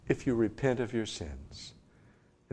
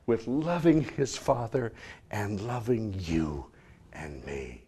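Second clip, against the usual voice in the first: ordinary delivery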